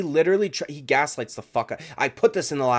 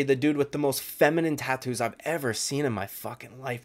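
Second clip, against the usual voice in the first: ordinary talk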